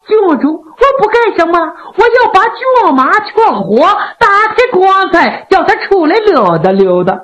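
high, airy innocent reassurance; breezy, high-pitched banter; wily official explaining. A narrator's reading. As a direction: make an outrageous plan sound perfectly ordinary. Kou Zhun answers the princess.

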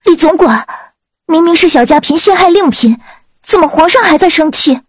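高声焦急地追问; 拔高嗓音替主子着急申辩. A narrator's reading. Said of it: "明玉急道". (焦急不解的质问)

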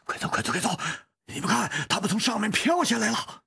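high, urgent warning; loud urgent call. A narrator's reading. Team member warns others of things floating down, loud urgent call. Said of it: "只是大声地说".

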